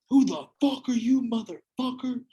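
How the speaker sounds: mock tough-guy yell at the stranger in bed; angry man. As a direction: bark it like a big angry boyfriend. Angry boyfriend confronts him.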